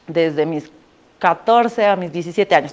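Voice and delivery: ordinary talk, nothing marked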